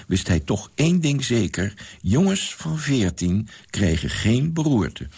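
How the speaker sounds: ordinary delivery, nothing marked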